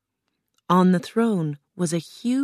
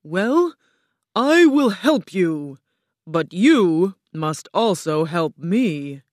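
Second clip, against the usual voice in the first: loud, grand, drawn-out voice; booming Oz; 6 dB louder, slower than usual. A narrator's reading. Oz questions the travelling girl, booming. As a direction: boom it out like a mighty wizard.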